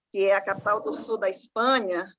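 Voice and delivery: ordinary delivery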